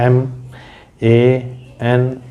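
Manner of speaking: slow, letters spelt out one by one; spelling out letter by letter. Shown as a demonstration. Teacher slowly spelling out a word for students, letter by letter. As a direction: spell the word slowly to show the wrong count. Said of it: "तो तीन syllables नहीं है".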